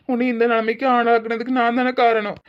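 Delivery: ordinary talk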